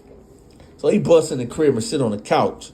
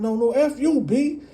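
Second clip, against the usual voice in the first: high, fast angry comeback; mock angry father; heated retort; 13 semitones higher than usual. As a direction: snap back at her.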